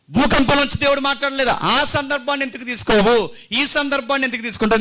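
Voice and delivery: high, forceful pulpit challenge; impassioned preaching. Real, not acted. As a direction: drive each question home at full force. (ఆవేశంగా, గట్టిగా ప్రసంగం)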